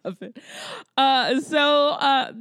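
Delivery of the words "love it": laughing. Real, not acted. Laughing at a funny scene.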